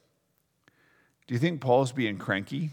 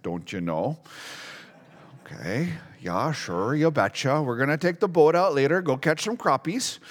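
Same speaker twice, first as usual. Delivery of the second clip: playful Minnesota accent, rising higher as it goes; Upper Midwest accent; 3 semitones higher than usual. Shown as a demonstration. Preacher showing off local speech, hammed-up Upper Midwest accent. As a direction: put on a folksy Wisconsin-Minnesota accent. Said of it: "a Minnesota, a North Dakota, and a Wisconsin accent".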